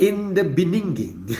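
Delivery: Beninging quoted as be-NING-ging, high and loud; Ninjago meme voice. Retold or imitated. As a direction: quote the meme, mangling beginning. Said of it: "The Ninjago master".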